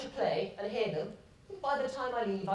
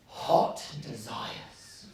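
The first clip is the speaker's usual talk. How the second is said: whispering; 8 semitones lower, much slower than usual. Acted. Confessing longing.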